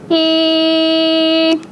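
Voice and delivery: steady, drawn-out "E" sound, modelled for pupils; sustained long E. Shown as a demonstration. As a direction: hold "E" as one long, level vowel. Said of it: "as long as I hold my fingers there".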